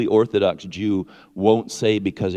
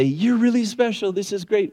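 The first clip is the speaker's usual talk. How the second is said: high-pitched gushing praise; mock pumping-up; 11 semitones higher than usual. Preacher mimicking people who pump others up, high-pitched hype. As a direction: gush like an overeager encourager. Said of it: "we don't have to pump people up".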